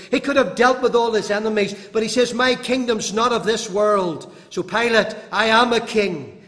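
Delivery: loud, driving sermon delivery; full-force preaching. Acted. Preacher drives home the trial before Pilate, full force. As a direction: preach it hard to the congregation.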